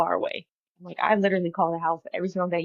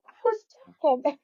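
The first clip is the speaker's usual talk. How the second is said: exasperated protest; 8 semitones higher, 3 dB louder than usual. Retold or imitated. Retelling her frustration to mom.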